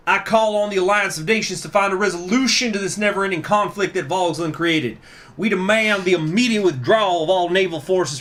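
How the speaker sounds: put-on high, forceful accented speech; politician's impassioned, accented address; Bush voice. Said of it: "while doing a Bush voice".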